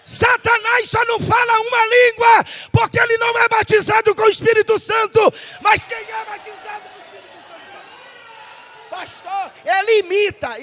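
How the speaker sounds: loud, driving pulpit preaching; fiery preaching. Acted. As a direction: preach it out to the whole church. Climax of sermon, leading the congregation. (pregação inflamada e bem alta)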